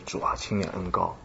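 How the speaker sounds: 几乎听不见的低声恳求; 跪着轻声对神说; 耳语般的祷告. Retold or imitated. 跪在后台独自祷告. (后台独自跪下，极轻声的祷告)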